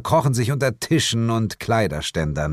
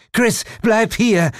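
slow, much higher and louder calling; desperate call; 6 dB louder, slower than usual. Acted. The woman calls slowly and loudly after her escaping friend. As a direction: call after someone running away.

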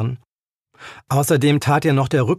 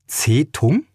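unbelieving. A narrator's reading. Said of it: "fragte sie ungläubig".

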